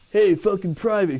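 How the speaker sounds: barked order, loud and high; drill sergeant yell. Retold or imitated. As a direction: shout it like a drill sergeant at a private. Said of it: "He'd go".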